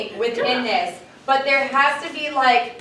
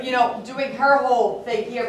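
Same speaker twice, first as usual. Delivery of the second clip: usual speaking voice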